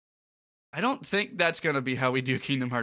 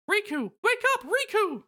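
Mickey Mouse voice. Acted. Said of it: "Mickey says".

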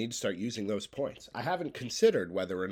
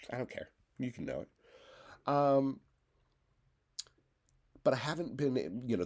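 ordinary talk, nothing marked

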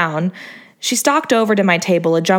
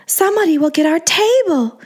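whiny half whisper; 9 semitones higher than usual. A narrator's reading. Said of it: "she half whined, half whispered".

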